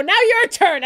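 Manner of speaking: joking. Acted. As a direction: toss it out as a joking dare. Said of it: "as a joke".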